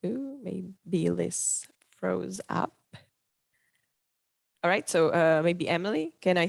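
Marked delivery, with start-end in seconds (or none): none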